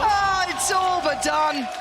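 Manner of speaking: slow, high, loud shouted call; drawn-out shout. Real, not acted. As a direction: stretch it out and scream it. Same words repeated.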